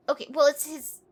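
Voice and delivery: ordinary talk, nothing marked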